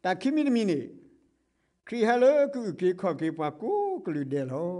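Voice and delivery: ordinary talk, nothing marked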